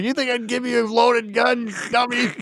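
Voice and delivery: high-pitched mock scorn; imitating Bert. Acting out Bert answering Melvin about the gun, mock scorn.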